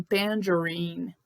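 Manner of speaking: slow "tangerine", g as English j; soft G. Shown as a demonstration. Teacher shows the G, slow "tangerine" with dj. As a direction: say "tangerine" slowly with a dj sound. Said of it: "Só o G tem que tá esse som".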